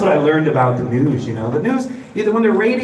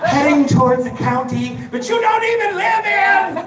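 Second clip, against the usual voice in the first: hyped news alert; 11 semitones higher than usual. Retold or imitated. Comic to the crowd, frantic newscaster voice. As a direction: ham up a frantic weather alert. Mock TV weather alert bulletin.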